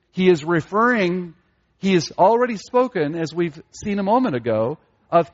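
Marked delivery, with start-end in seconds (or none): none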